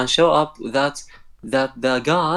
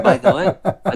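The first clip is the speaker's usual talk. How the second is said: laughing; 5 semitones higher than usual. Real, not acted. Explains while still laughing.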